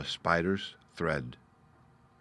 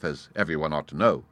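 ordinary delivery, nothing marked

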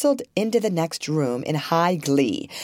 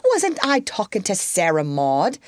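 high rebuke; scolding mother; 11 semitones higher than usual. A narrator's reading. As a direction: put them in their place.

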